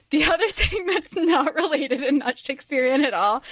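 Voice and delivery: laughing as the words come out; cracking up mid-sentence. Real, not acted. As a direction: talk through laughter. Cracking up while telling it.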